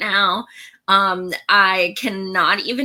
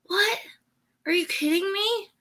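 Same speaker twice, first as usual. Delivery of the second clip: much higher, softer squeak of disbelief; stunned disbelief; 12 semitones higher, 5 dB quieter than usual. Retold or imitated. Host reacting to a stranger offering a new computer, high stunned disbelief. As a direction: gasp it out like you can't believe the offer. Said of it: "And I'm like".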